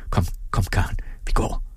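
voiceless, coaxing whisper. A narrator's reading. Father draws daughter away from the bed, whispering. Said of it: "hviskede han".

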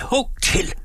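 high-pitched goading cry; understated scream, pitched high; frantic cry. A narrator's reading. Peasant goading the forester to strike, frantic cry. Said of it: "skreg bonden ud af sig selv".

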